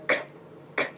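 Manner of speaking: "k" as a soft puff with no voice; voiceless consonant. Shown as a demonstration. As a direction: release "k" without voicing. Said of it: "k是清辅音". (清辅音“k”)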